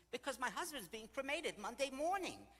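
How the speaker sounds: high, loud explanation; exasperated retort. Retold or imitated. Widow explaining to friends why she'll miss lunch, exasperated. Widow snaps back her excuse.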